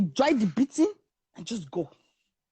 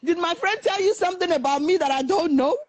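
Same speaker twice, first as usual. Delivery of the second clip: higher-pitched, affronted question; indignant challenge; 6 semitones higher than usual. Retold or imitated. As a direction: imitate an offended friend demanding an explanation. Friend confronts man over insult.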